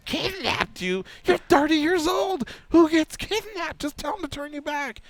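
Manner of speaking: high-pitched mock wife, squawking in disbelief; scolding wife. Wife hearing her husband claim kidnapping, high mock scolding. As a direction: mimic an incredulous wife telling him off, way up high.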